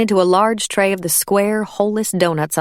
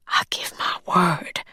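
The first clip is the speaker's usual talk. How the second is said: hushed plea; 4 dB quieter than usual. Acted. Tearful maid pleading.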